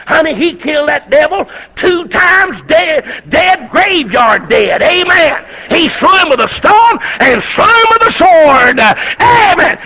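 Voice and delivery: high, loud shouted preaching with drawn-out words; preaching at full force. Acted. Preacher shouting triumph over the slain giant, full-force preaching. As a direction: hammer home the victory with each amen.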